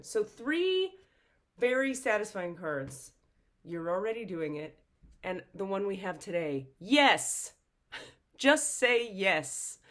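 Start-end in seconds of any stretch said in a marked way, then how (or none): 0.0-3.1 higher and louder, thrilled; excited. Real, not acted. Delighted count. 6.8-9.8 loud, laughing, gleeful yes; excited. Real, not acted.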